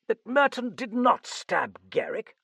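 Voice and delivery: high, loud question on "did not stab"; incredulous cry. A narrator's reading. Sir John challenging the Frenchman's claim, incredulous. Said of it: "Sir John cried".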